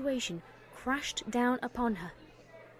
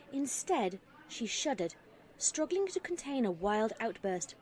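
ordinary delivery

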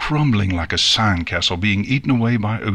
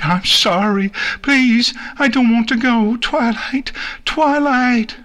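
put-on very high, frantic child's pleading; panicked calling out; 13 semitones higher than usual. Nix calls to Twilight while carried away, in panic. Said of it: "calling out to her in panic".

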